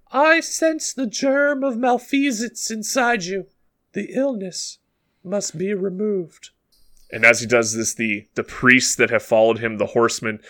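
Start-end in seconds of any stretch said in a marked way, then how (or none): 0.1-6.5 put-on high, measured child-prophet voice; priestly young child. Blind cult child warns the village of danger, priestly child voice. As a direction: preach solemnly in a put-on little-kid pitch. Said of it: "And the boy once again speaks".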